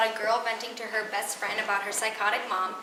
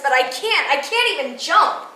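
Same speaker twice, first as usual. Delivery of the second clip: panicked protest; 3 semitones higher, 7 dB louder than usual. Acted. Frozen on high dive, retelling dream.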